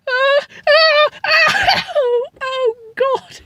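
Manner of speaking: high pained moans; over-the-top death groans, pitched high; hammed-up dying groans. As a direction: moan like you've been shot. Shot wife groans in pain.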